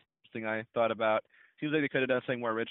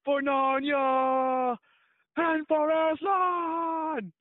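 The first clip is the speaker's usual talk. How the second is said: very slow, much higher, louder mock war shout; drawn-out battle cry; 6 dB louder, much slower than usual. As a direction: stretch every word like an epic trailer hero yelling to his army. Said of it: "What does it sound like in the first one?".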